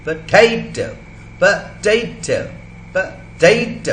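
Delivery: पोटैटो as puh, TAY, toh, first vowel kept; पोटैटो with a clear schwa after P, middle syllable punched; syllables said separately. Shown as a demonstration. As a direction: break पोटैटो into syllables, stressing the second. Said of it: "इसको हमने separately बोला".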